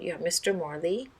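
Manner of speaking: ordinary delivery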